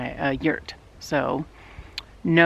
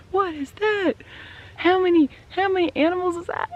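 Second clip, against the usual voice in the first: startled. Retold or imitated. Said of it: "I was like".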